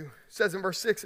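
normal talking voice

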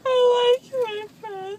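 crying. Real, not acted. Groggy daughter crying.

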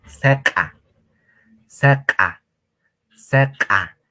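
"Seca" said loud and clear, stressed; loud drill word. Shown as a demonstration. Teacher drilling students, loud clear word. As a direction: call out "Seca" firmly for the class. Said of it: "Resistente, duro, fuerte también".

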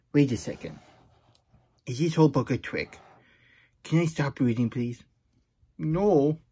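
ordinary delivery, nothing marked

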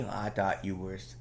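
ordinary delivery

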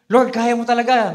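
confident believer. Pretend. Voicing faith.

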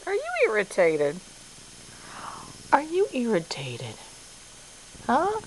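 ordinary talk

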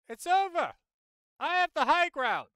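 high, loud movie-hero yell; mock triumphant shout. Streamer playfully declaring victory in a game, hammed-up shout. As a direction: shout it out dramatically, far above talking pitch.

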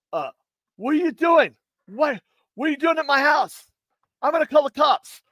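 high, alarmed voice of a startled mailman. Retold or imitated. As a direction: squeak it out like a shocked stranger at the door. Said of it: "And he's like going".

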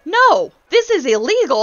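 vocal objection. Retold or imitated. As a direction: call it out loud and sharp. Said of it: "I was very vocal about that one".

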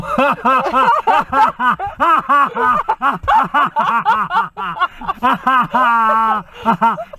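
long, high-pitched belly laugh; hearty laughter. Real, not acted. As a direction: crack up at your own joke. Cracks up at own joke.

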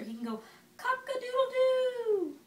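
high, loud rooster call; rooster crow. Acted. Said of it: "He can sound like a rooster".

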